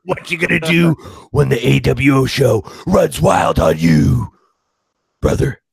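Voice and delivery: loud, hammed-up wrestling promo; wrestler promo parody.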